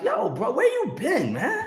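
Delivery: high, slow, amused imitation of his sister's friend; his sister's friend asking where he's been. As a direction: play the friend teasing, high and drawn out. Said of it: "her friend are like".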